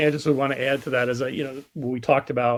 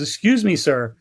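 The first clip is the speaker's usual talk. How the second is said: higher, louder mock call to someone below; 4 semitones higher, 5 dB louder than usual. Balloonist calling down to the man on the road, calling out. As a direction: hail a stranger from afar, politely. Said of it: "he calls out to the man".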